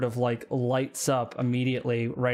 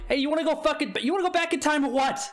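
higher, fast exasperated comedian rant; Bill Burr; 13 semitones higher, much faster than usual. Retold or imitated. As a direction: fire it off, impatient and brash. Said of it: "He's just like".

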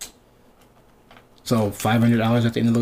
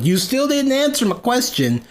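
higher-pitched, insistent retort; 10 semitones higher than usual. Retold or imitated. Retelling a reply to Ryan dodging the question, insistent. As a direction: press the point in a high, exasperated voice.

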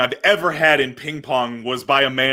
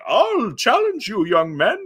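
put-on high, slow creaky old man; 10 semitones higher, slower than usual. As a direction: play the challenging old guy. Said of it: "he's like".

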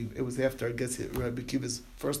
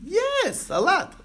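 high, louder burst of yes; eager yes; 5 dB louder, much slower than usual. Real, not acted. Teacher answering a student's question about kids, eager and high. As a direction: jump in keen to answer.

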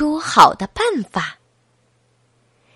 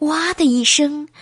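ordinary delivery

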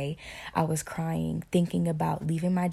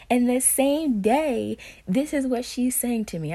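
higher and louder, slowing down on same day; real excitement building as she tells it; excited; 7 semitones higher, 5 dB louder than usual. Real, not acted. Vlogger telling viewers about aunt's message, excited. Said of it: "I know you probably heard me get excited".

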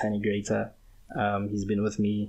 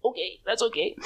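squeaky high reply; 13 semitones higher than usual. Retold or imitated. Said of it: "And I was like".

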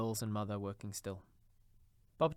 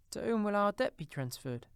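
high, louder woman's voice; anxious wife; 11 semitones higher, 6 dB louder than usual. A narrator's reading. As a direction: ask it high, as a worried wife. Worried wife asks her husband.

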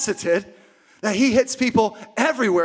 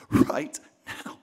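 whisper; 4 dB quieter than usual. Real, not acted. Whispered tail of the hush.

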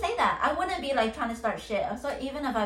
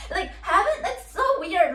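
higher, louder, laughter in the voice; laughing in disbelief; 7 semitones higher, 4 dB louder than usual. Real, not acted. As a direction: laugh through your disbelief. Laughs at how stupid it is.

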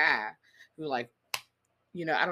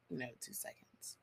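breathy aside under her breath; whisper; 3 semitones lower, 19 dB quieter than usual. Real, not acted. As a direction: drop to a whisper. Hushed aside in the story.

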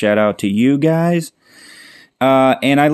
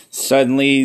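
ordinary talk, nothing marked

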